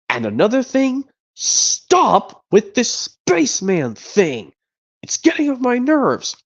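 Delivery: high, slow, exasperated complaint; irritated Woody. Retold or imitated. A mild Woody nagging Buzz about the spaceman act. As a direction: drag out every word in annoyance. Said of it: "he tells him another thing".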